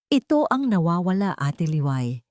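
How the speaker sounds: usual speaking voice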